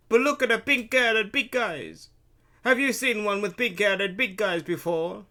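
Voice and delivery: burly vendor Luigi. A narrator's reading. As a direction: gossip loudly as a heavy-voiced vendor. Luigi voiced in the story.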